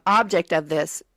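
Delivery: ordinary talk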